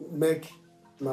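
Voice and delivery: usual speaking voice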